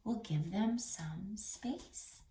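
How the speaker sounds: slow, soft whisper; gentle whisper. Real, not acted. Reading teacher gently whispers about kindness.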